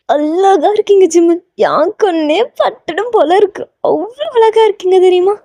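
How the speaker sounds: high, drawn-out fangirl praise; gushing admiration. Acted. Fan telling Jimin how beautiful he looks, gushing. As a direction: coo over him in a lilting high voice.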